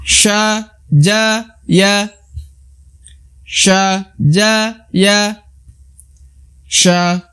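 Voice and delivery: sha, ja, ya chanted high; recitation drill. Shown as a demonstration. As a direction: call out letters for the class. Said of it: "teman-teman bisa ikuti".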